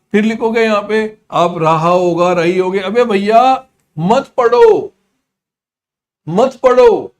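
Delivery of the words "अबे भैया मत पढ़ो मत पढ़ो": loud, high, drawn-out plea; emphatic exhortation. Acted. Teacher warning students off the textbook method, loud exhortation. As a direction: call it out high and loud, stretching the words.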